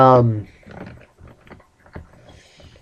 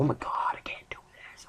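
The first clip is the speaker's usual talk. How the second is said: whispered freak-out; 17 dB quieter than usual. Retold or imitated. Kid freaking out because she was crying, panicked whisper. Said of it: "I was freaking out 'cause she was crying".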